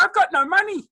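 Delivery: high squeaky panicked yelp. Retold or imitated. Panicked squeal at ring price.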